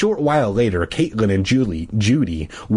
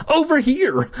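high urgent call; slower than usual. A narrator's reading. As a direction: call him over, pressing. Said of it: "I urged".

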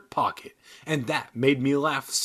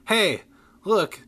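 excited spotting; 5 semitones higher, 6 dB louder than usual. A narrator's reading. Excited fan spotting star.

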